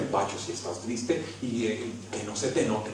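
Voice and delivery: low, soft, hushed imitation of the coordinators; mock coordinators' advice. Coordinators warning the young leader, hushed low imitation. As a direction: mimic the stern advice under your breath. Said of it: "la coordinación de mi comunidad a decirme".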